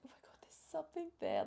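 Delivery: high breathy whisper of shock; awed whisper of discovery; whispered cry. Retold or imitated. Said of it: "I cried to Adam".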